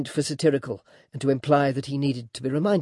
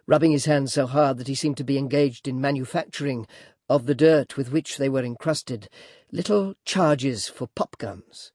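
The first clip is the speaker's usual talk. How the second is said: ordinary delivery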